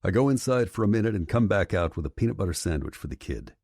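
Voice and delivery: ordinary delivery, nothing marked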